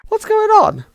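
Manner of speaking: high, loud, frantic cries; panicked shouting. Acted. Friends shouting as Ryan collapses, panicked. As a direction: alarm when a friend drops.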